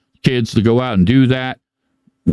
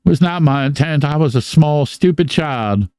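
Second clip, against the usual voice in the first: ordinary delivery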